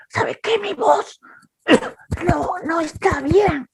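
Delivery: high, soft, slow, timid excuse; nervous excuse. Retold or imitated. Scared preacher excusing himself to the crowd, high and timid. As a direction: shrink away and plead a bad voice. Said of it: "Dije esto".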